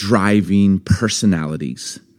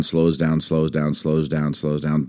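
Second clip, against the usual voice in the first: each slows down lower and softer; fading slowdown; 8 semitones lower, 7 dB quieter, slower than usual. Retold or imitated. Describing the slowing driver, voice sinking and fading. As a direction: let the words wind down like a stalling car.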